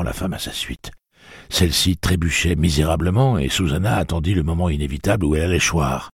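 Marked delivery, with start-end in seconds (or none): none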